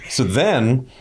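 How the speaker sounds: excited admission with a laugh; laughing, keyed-up aside; real excitement. Real, not acted. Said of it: "I get excited about this stuff".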